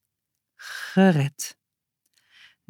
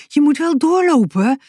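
higher, startled urging; shaken, pushing her on; 8 semitones higher, 3 dB louder than usual. A narrator's reading. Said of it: "zei Boutje geschrokken". (geschrokken, hoger aansporen)

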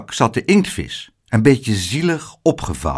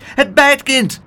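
very high-pitched, vexed little doorman; indignant little gatekeeper; outraged cry; 4 dB louder, slower than usual. A narrator's reading. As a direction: exclaim in high alarmed annoyance. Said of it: "werd hij vuurrood van ergernis".

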